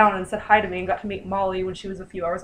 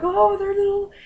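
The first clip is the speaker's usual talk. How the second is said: higher, sing-song gushing moms; gushing mothers; 9 semitones higher than usual. Retold or imitated. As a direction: coo over the baby like doting mothers. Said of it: "the mothers were like".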